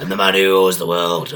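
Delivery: loud, dumb wrestler bellow; mock Sid shout. Showing how Sid really says it, a loud dumb shout. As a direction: shout it with no inflection. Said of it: "You wouldn't say it like that".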